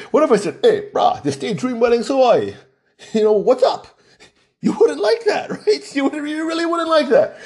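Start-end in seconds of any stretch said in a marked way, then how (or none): 0.6-3.9 higher, casual Hawaiian pidgin greeting; pidgin phone greeting. Shown as a demonstration. Wedding host greeting a caller improperly, in Hawaiian pidgin. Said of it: "heard me speaking pidgin". 4.6-7.4 much higher, laughing through the words; amused. Real, not acted. Host joking to listeners about his pidgin greeting, highly amused.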